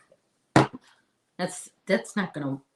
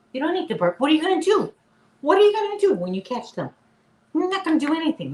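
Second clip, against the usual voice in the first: higher, louder mock scolding; high, loud fast-paced scolding of a tiny dog; teasing scold; 11 semitones higher, 7 dB louder, faster than usual. Real, not acted. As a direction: tease the puppy for barking.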